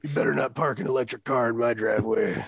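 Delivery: Alex Jones impression. Shown as a demonstration. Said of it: "Jerry Seinfeld as Alex Jones".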